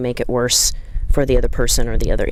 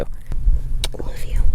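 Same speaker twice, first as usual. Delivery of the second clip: whisper; much slower than usual. Retold or imitated. Comforting the crying rider.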